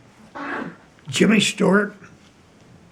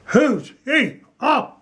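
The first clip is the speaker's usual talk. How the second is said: mocking blank voice; 9 semitones higher, slower than usual. Mimicking her blank reply.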